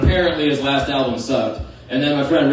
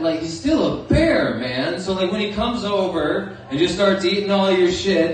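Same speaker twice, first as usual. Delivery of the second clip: ordinary talk, nothing marked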